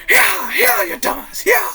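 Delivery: high-pitched yell without full volume; squeezed, very high mock shouting. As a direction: yell like a rider whipping on a mount. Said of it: "all you hear him yell is".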